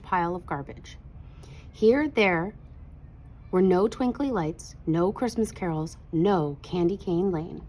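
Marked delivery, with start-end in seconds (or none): none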